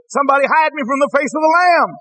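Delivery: high, urgent preacher's cry for refuge; theatrical plea for rescue; acted desperate plea. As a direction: beg to be hidden, in dramatized dread. Acted plea to hide from judgment.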